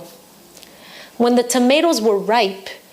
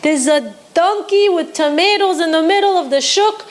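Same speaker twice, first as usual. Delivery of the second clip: pitched way up, calling out across the market; excited scream; 7 semitones higher than usual. Acted. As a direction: yell for everyone to come and look. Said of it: "he started to scream".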